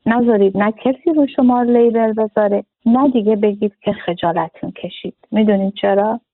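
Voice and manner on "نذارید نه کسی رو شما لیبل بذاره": ordinary talk, nothing marked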